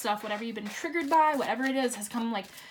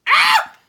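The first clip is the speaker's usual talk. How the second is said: very high joyful shriek; real delighted scream; excited scream; 16 dB louder than usual. Real, not acted. Card reveal joy.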